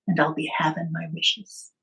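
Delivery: firm whisper, voice dropped right down; a secret whispered threat; whispered challenge. Retold or imitated. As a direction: hiss the demand low and steady so only he hears. Girl confronts the leprechaun.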